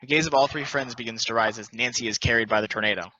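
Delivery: ordinary delivery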